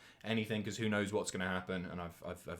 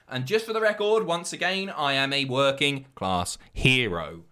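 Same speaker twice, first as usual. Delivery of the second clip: loud, raised start, slowing to a weighty finish; emphatic declaration; 3 semitones higher, 6 dB louder than usual. Real, not acted. Streamer insisting to viewers he isn't posh, emphatic declaration. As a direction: declare it grandly for the record. Mock-solemn denial of being posh.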